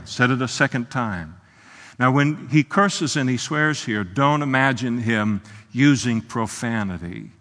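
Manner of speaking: ordinary talk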